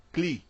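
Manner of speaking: CLEE with a long 'ee'; isolated demo syllable Clee, rhyming with key; corrected syllable. Shown as a demonstration. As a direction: say Clee as klee, never klay. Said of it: "fala Clee".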